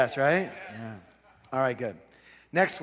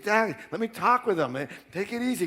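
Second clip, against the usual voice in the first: higher-pitched, mock-flustered placating; acted pleading; 6 semitones higher than usual. Son calming his raging father, acted pleading. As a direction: imitate trying to calm an angry dad.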